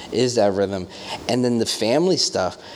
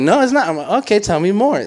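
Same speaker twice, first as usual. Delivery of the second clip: animated; 11 semitones higher, 7 dB louder than usual. Retold or imitated. Continuing loud retold exchange.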